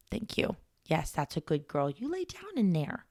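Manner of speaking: hushed, cooing aside to a dog; coaxing pet talk. Real, not acted. Podcaster settling the dog away from the camera, soft coaxing. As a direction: softly praise a dog, swooping up sweetly. Praising the dog mid-recording.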